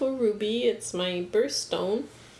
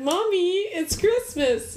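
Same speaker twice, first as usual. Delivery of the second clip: put-on higher, eager little boy; excited child voice; 8 semitones higher than usual. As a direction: imitate a kid waking mom. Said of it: "opened the door and said".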